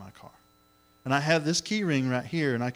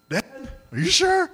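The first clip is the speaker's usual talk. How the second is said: put-on high, slow, thin boy's voice pleading; a scared child asking his father to be sure; frightened son Isaac; 5 dB louder, slower than usual. Isaac asking his father on the altar, high slow fearful plea. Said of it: "And I'm sure Isaac's thinking".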